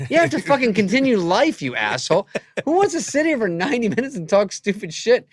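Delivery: roasting Norm about a ninety-minute show; mock rant. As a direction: blow up at a friend for laughs.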